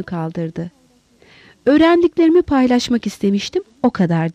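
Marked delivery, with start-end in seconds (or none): none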